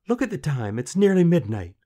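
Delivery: put-on loud, high, agitated girl; frantic rebuff. A narrator's reading. Queen to the lad near midnight, frantic.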